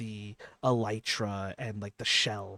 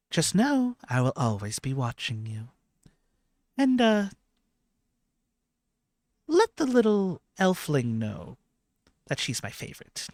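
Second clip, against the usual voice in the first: higher, louder opening that settles down; lofty goddess giving a parting word, easing off at the end; cat goddess; 6 semitones higher, 9 dB louder than usual. Acted. Game master plays cat goddess.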